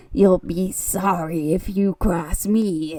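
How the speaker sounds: slow, put-on creepy threat; hammed-up goblin menace; menacing masked freak. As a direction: draw out a mock monster warning. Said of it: "gets in his face".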